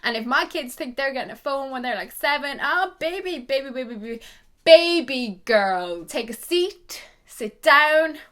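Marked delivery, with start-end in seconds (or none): 2.6-8.2 mock scolding mum. Scolding future kids.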